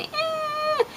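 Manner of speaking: very high, soft whiny "Eh"; toddler's whiny grunt. Retold or imitated. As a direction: whine it like a toddler wanting something. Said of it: "she starts going".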